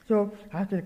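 ordinary talk

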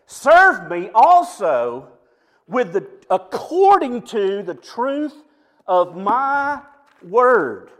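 SERVE drawn out and pushed loud, each phrase spaced; preacher booming God's command, slow and loud; slow, loud stress on serve. Acted. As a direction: hammer the command slowly, word by word.

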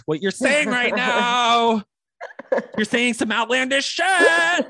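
slow, affronted Karen voice; entitled customer. Pretend. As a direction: drawl it out like an offended Karen. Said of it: "Are you being Karen?".

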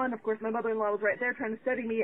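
ordinary talk

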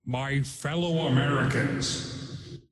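slow, drawn-out campaign address; wrestler-politician impression, stretched out; Jesse Ventura. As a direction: open a mock presidential promo, very slowly. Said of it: "of Jesse Ventura".

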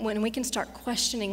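ordinary talk, nothing marked

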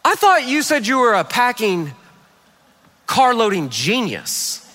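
imitating wife's pointed puzzlement; puzzled. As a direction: question his boast with long pauses. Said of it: "she kinda got this puzzled look".

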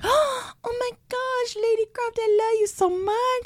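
high, slow, gushing put-on foreign accent; a husband thanking his wife with a hammed-up accent on Christmas morning. Husband gushing over his Christmas gift, high mock foreign accent. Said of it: "I don't know where that accent came from".

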